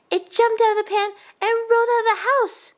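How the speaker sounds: put-on high-pitched child telling a tale; excited young boy. Youngest boy explaining the escape, high and eager. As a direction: pipe up with breaking news.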